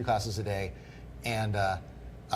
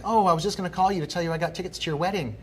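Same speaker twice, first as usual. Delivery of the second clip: higher, fast chirpy agent voice; chirpy agent impression; 6 semitones higher, faster than usual. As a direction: play the clueless agent, rattling it off. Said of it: "She's like".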